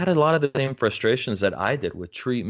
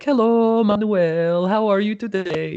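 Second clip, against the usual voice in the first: imitating a Norwegian grandma's accent, much higher and louder; sing-song Norwegian accent in a bright high voice; playful high Norwegian accent impression; 12 semitones higher, 6 dB louder, slower than usual. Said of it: "she's got this Norwegian accent".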